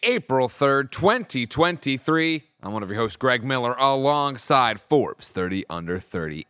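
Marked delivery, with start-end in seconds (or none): 0.0-2.4 loud, high announcer welcome; big host welcome, pitched high and loud; hyped show intro. Acted. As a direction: kick off the show like a hype host.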